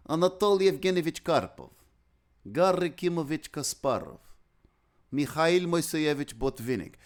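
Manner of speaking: showing off Russian name pronunciation. Shown as a demonstration. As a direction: roll out the Russian patronymics with Russian accent. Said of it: "I can really pronounce a Russian name in good Russian".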